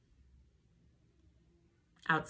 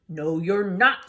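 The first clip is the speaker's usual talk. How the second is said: loud, slow troll voice; 6 dB louder, slower than usual. Acted. Troll blocking the goat's way, loud and slow. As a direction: bark a flat no at the goat.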